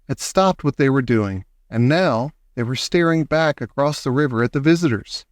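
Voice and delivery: plain reading voice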